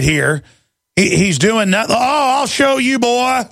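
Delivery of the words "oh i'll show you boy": mock cop threat. Mocking cop grabbing protester.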